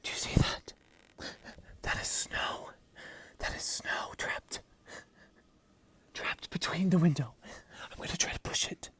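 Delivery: hushed whisper up close. Real, not acted. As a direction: keep it to a whisper. Whispering at window snow.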